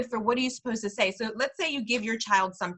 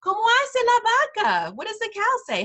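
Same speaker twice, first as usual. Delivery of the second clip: playful question; 10 semitones higher, faster than usual. Shown as a demonstration. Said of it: "I just use la vaca as an example".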